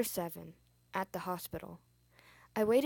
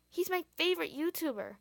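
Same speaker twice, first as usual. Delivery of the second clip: put-on kid voice; 8 semitones higher, 4 dB louder than usual. A narrator's reading. Kid character speaking.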